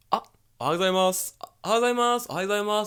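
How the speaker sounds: put-on high, quick chorus of old villagers; sing-song greetings from every old neighbour; old people greeting. Old villagers greeting the teacher, high and quick. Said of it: "all the old people".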